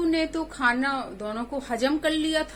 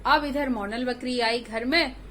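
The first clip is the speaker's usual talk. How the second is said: usual speaking voice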